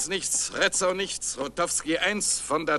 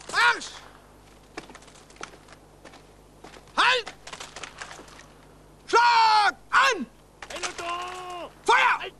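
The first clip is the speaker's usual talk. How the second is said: shouting commands; 13 semitones higher, slower than usual. Acted. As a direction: bark each order at the squad. Barked drill commands, shouted.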